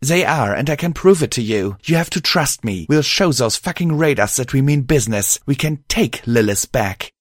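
high-pitched, heated insistence; angry mare. Acted. Mare swearing they can take Lilith back, angry. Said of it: "Pride replied angrily".

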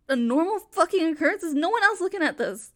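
demanding the room notice; loud disbelief. Acted. Woman asking bystanders if this is normal, loud and disbelieving. Calling out to the crowd.

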